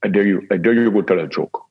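put-on botched German accent, drifting off target; retried German accent. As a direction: try hard at German, miss the accent. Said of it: "try it again? I think you can do better".

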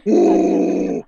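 loud hyper-masculine caveman porn moans; caveman grunting. Retold or imitated. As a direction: bellow drawn-out caveman sex noises. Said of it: "like, cavemanish. Like, they make these sounds".

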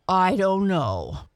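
touch of a dancing bear; Dido. A narrator's reading. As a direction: answer as a big hearty bear. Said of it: "answered Dido".

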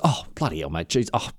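low, soft, put-on amazed thanks; hushed, hammed-up thankfulness; mock gratitude. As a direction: gush quietly as if overwhelmed. Assassin feigning gratitude, receiving money.

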